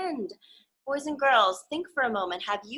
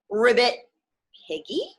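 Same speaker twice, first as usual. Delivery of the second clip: ordinary delivery, nothing marked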